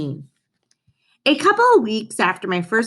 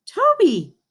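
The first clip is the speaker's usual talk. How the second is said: high, startled cry of the name; surprised gasp; 12 semitones higher than usual. Acted. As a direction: caught off guard mid-run. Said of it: "Tyler gasped in surprise".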